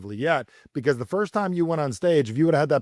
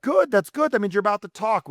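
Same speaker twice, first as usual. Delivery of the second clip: enthusiastic reassurance; 8 semitones higher than usual. Retold or imitated. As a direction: celebrate the nerves as a good sign. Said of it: "someone's saying".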